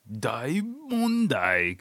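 drawn-out, high mock TV alarm; overdramatized news. Mimicking how the Japanese news does it, slow overdramatized cry. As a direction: stretch it out like a melodramatic headline. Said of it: "dramatized movie, sh-, uh, T V show".

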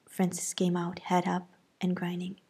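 plain reading voice

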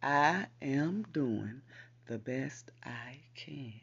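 slow, deliberate, each word spaced; slow repeat. Shown as a demonstration. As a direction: lay out "I am doing the best I can" slowly. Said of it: "I can say it again for you".

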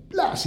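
sharp high "plas" imitating a stick strike; slap noise. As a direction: smack the sound out. Slapping prisoners' hands with sticks.

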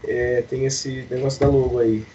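ordinary talk

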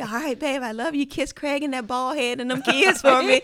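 high, loud doting country dad; affectionate phone sign-off in a country accent; dad's country accent. Retold or imitated. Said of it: "my dad has a country accent".

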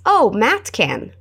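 very slow teaching repeat; slow it down for the class to echo; deliberate slow model. Shown as a demonstration.

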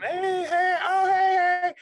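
loud, high hey calls from the crowd; people hailing him. Retold or imitated. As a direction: shout hey to grab his attention.

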